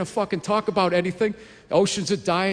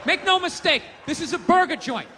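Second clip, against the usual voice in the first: shouting rant; 10 semitones higher than usual. Acted. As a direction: yell it like a fed-up owner. Ranting restaurant owner bit.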